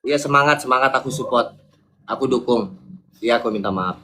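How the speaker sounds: low, half-hearted encouragement; forced support. Real, not acted. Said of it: "Minta maaf terpaksa kayak gitu". (dukungan setengah hati bernada rendah)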